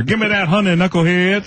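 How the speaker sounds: high Barkley growl at a bear. Retold or imitated. Impressionist playing Barkley robbing a bear, high and hammy. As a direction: ham up Barkley demanding the honey.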